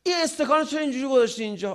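high, loud, rapid mock scolding; petty angry nagging. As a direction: imitate a spouse flaring up over a trifle. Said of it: "سر چیزای بیخود". (ادای سرزنش عصبی، بلند، زیر و تند)

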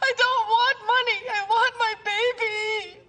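desperate crying plea. Acted. Pleading with Shayda for her baby, crying. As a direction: beg through sobs for the baby.

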